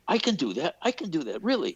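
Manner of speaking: high, punchy boast to the room; swooping high-pitched retold exclamation; Mel Brooks. Director turns to the guys in the room, high mock-indignant voice. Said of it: "he turned to the guys in the room".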